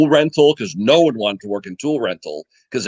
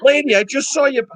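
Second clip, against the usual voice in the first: higher, stress on "saw" in "Lady, I just saw you"; exasperated retort; 13 semitones higher than usual. Retold or imitated. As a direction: pitch up and lean hard on "saw". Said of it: "And I'm like".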